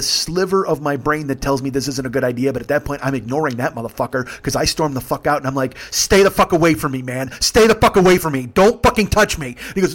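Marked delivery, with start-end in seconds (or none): 5.9-9.5 loud, raised, forceful repeated commands; retold angry warning. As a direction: warn them off with rising heat. Teller storms out warning him, retold.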